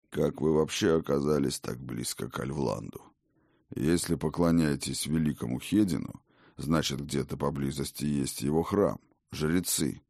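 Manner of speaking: put-on low questioning old god; old king voice. Odin asks.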